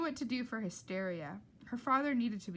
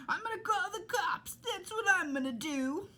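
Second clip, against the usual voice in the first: higher, louder blustery yell; shouting cook; 10 semitones higher, 5 dB louder than usual. Acted. Cook shouting at the diner, higher and louder. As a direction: bellow the threat at the room. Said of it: "The cook shouted".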